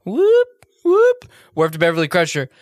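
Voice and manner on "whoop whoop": high, loud alarm siren; alarm whoop. Retold or imitated. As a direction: mimic a ship's alert siren. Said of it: "and it's like".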